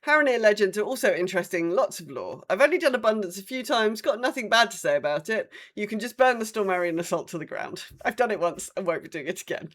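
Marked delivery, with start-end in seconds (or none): none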